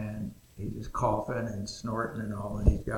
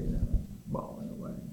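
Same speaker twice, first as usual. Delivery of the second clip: ordinary delivery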